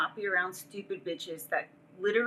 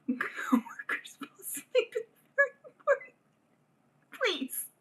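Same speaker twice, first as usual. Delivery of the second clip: mock crying plea; slower than usual. Retold desperate plea.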